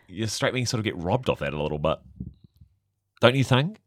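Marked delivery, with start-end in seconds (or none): none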